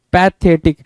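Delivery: usual speaking voice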